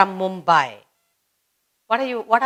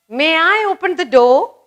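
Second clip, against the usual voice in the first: higher, louder, rising question; polite asking; 7 semitones higher, 6 dB louder than usual. Shown as a demonstration. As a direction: ask sweetly with a rise at the end. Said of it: "you're asking".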